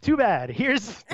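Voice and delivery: high, cheeky brush-off; hammed-up too-bad retort; mock dismissive. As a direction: wave away the complaint gleefully. Voicing the show's cheeky reply.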